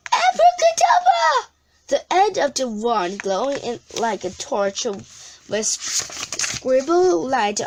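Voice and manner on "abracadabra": high ringing spell cry, much louder; spell shout. A narrator's reading. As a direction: fling the magic word out high and loud. Witch casting a spell.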